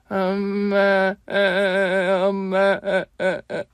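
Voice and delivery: put-on high, slow bawling child; a little boy sobbing for his mother; child wailing. Ten-year-old before his mother's photo, bawling. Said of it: "엉엉 소리내 울고 있더군요".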